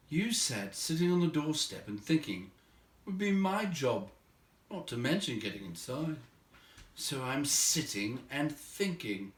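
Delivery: a hint of a weary hobbit answering back; mildly put-upon hobbit reply. Bilbo answers the dwarves.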